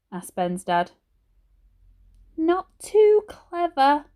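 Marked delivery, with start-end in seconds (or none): 2.4-4.1 put-on high, slow, croaky old lady voice; a frail grandmother in a hospital bed, slurring slightly; croaky old granny. A narrator's reading. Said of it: "Her voice was croaky and her speech a little slurred".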